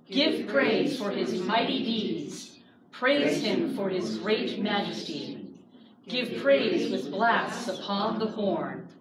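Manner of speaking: slow, measured psalm recitation; solemn scripture reading. Acted. Teacher reading Psalm 150 to the class in a slow, solemn voice. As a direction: read each line like a call to worship.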